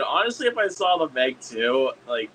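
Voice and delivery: normal talking voice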